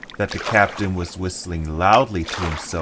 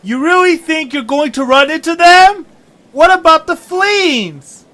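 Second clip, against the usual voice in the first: very high, loud worried question; alarmed small voice; 12 dB louder than usual. Acted. Young traveller asking the captain about the fleens, high and loud. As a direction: call out your worry high and sharp.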